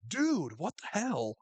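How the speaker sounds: high, drawn-out "dude" of disbelief; mock outrage. [name] reacting to Travis taking snacks, mock outrage. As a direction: stretch it out, appalled at a friend.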